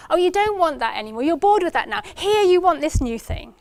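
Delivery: a hint of a cooing mother; mum's coaxing voice. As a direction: coax the baby toward the new toy. Mum voiced taking toy away.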